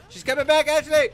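yelling warning. Real, not acted. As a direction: yell the warning to her. Warning teammate loudly.

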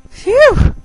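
high, loud whoosh of relief; exaggerated storybook sigh of relief; relieved phew. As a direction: blow out a big high phew. Relief at the worm's escape.

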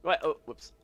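ordinary delivery

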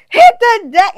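loud, high mock warning shout; retold yell.

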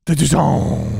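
loud gruff witcher call. Pretend. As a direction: bellow it like a grizzled hunter. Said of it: "So I can do my Witcher voice".

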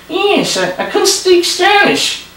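high, whiny puppet voice; stubborn little dummy refusing; squeaky ventriloquist dummy. Acted. Dummy punchline.

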